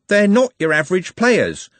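urgent high-pitched outburst; blurted out. A narrator's reading. Trev warning about the other team's players, blurted out. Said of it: "Trev blurted out".

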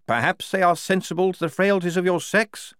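a gallant lieutenant being polite; lieutenant Blouse. Acted.

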